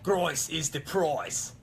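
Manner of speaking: slow, drifting into Australian while doing German; a German line that slips into Australian; botched German accent. Pretend. A failed German line comes out sounding Australian. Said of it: "That was Australian saying German".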